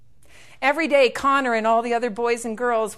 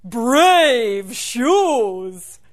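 loud, booming, drawn-out tree voice; talking tree; 10 dB louder, much slower than usual. Acted. Tree admiring the leprechaun's new shoes, booming. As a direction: hail it like a big old tree. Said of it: "And the tree said".

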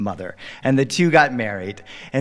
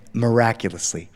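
usual speaking voice

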